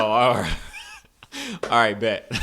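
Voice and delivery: loud, laughing mock acceptance. Telling a friend about the Paris snub, laughing loudly. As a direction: laugh through a shrug. Laughing retelling French refusal.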